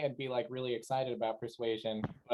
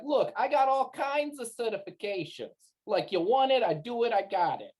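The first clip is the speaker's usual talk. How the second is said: higher, louder boastful in-character bluff; bragging bluff; 8 semitones higher, 3 dB louder than usual. Acted. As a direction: hype it up, pitched high and punchy. Player acts bragging with fake credentials.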